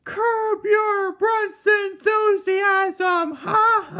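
high, loud, slow shouted proclamation; booming shout. Acted. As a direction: bellow it like a mad villain. Villainous mock proclamation.

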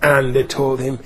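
ordinary talk

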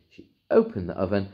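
plain reading voice

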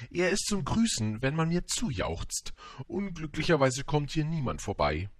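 high, self-important vain voice; vain man. Acted. Vain man explains his hat to the prince, high conceited voice.

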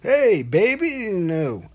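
loud, high, slow croon of a greeting; overdone sweet-talking hello; drawn-out flirty greeting. Acted. Botched opening of a video message.

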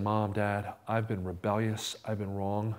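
low, hushed confession; soft, low retold apology; contrite confession. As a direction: humbly own up to parents.

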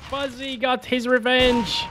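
normal talking voice

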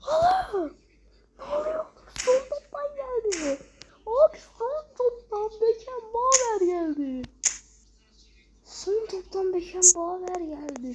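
high, shaky stunned voice; real stunned excitement fading; breathless disbelief. Real, not acted. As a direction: still reeling from the shock. Calming after pull, still shaken.

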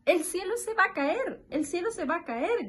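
high, quick-fire alarm cry; mock shouting animals. A narrator's reading. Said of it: "gritaron los animales". (grito agudo de animales asustados, fingido)

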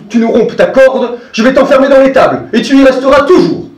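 exasperated outburst. Acted. Seguin refusing to let his goat go, loud exasperated outburst. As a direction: scold her and lay down the law. Seguin angrily locks her up.

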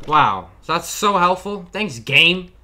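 slow, loud sarcastic praise; mock the useless map. Real, not acted.

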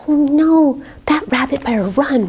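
plain reading voice

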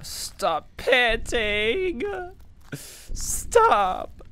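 loud, high, drawn-out "stop"; strained, pleading shout; exasperated yell. Real, not acted. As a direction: yell it slowly, stretching each word. Yells at panting game character.

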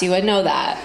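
usual speaking voice

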